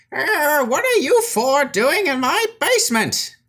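put-on high, startled old wizard. GM voices the wizard finding thieves in his basement, high startled old man. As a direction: squeak it out like a surprised old man. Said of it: "you hear a voice say".

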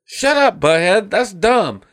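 high, hurried cartoon voice; Beavis. Scolding Butthead about the garbage can, high mock voice. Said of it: "Beavis goes".

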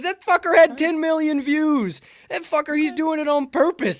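loud, high-pitched insistence; worked-up, shouted defence; fired-up rant. Real, not acted. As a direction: drive it home at the top of the voice. Heated defence of the coach.

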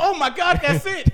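high, loud cry of discovery; Roscoe's eureka yell; excited shout. Retold or imitated. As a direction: blurt it out like you just struck gold. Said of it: "go like".

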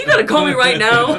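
high, loud mock outrage through laughter; outraged complaint. Reveler complaining to collector about timing, loud outrage. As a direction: yell it in laughing disbelief.

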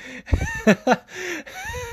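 real burst of laughter, very high; high-pitched laugh. Real, not acted. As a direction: crack up laughing, near tears. Laughing at the tattoo story.

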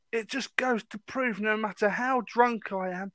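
drawn-out mock-triumphant parting shot; drunken boast. Imitating the drunk man's parting boast.

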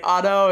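OTTO with a flapped middle, like "ah-doe"; name said plainly. Retold or imitated. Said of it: "he's like".

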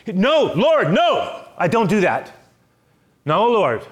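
high, loud insistent no; loud stubborn refusal. Acted. Peter refusing the Lord again, loud and high.

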